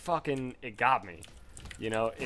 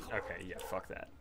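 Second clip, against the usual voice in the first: much softer, under the breath; muttered aside; 5 semitones lower, 12 dB quieter than usual. Real, not acted. Streamer recalling spider, soft muttered aside. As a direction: mutter it to yourself.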